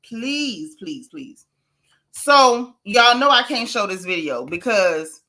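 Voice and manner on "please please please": pleading that trails off softly; mock plea, softer on each please; playful begging. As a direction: beg the viewers, fading to a small voice. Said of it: "Okay, I'm begging".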